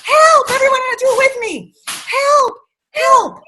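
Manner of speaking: loud, high cries for help; character yelling help to the animals. Acted. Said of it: "So he yelled out".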